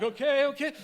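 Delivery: rattled mom coping out loud; mom's flustered okays. Retold or imitated. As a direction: mom talks herself through reversing. Mom backing up the car.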